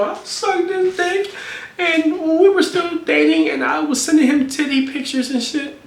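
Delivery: high, slow whiny imitation of her; mock girlfriend voice. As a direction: mimic her confession in a high voice.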